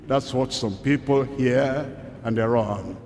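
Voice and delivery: normal talking voice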